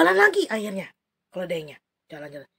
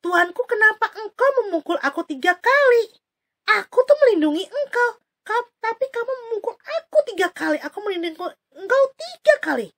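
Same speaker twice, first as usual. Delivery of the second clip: higher, plaintive pleading voice; talking donkey; 10 semitones higher, 3 dB louder than usual. Acted. The donkey asks its master why, higher and plaintive. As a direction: reproach your master gently. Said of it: "Keledai bilang".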